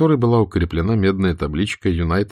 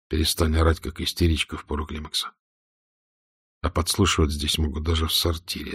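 quiet; 4 semitones lower, 6 dB quieter than usual. Acted. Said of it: "тихо ответил Штирлиц".

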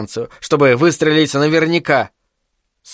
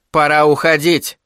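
usual speaking voice

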